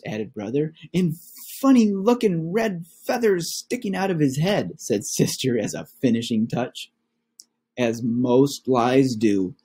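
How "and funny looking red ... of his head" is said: put-on higher child voice piling on details; a lightly voiced girl cub, keen to impress; eager little cub. Sister cub adds detail.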